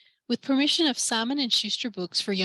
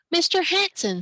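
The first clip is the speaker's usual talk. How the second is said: higher call to a neighbour down the street; 8 semitones higher than usual. Acted. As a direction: call out to get his attention. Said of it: "Sam called".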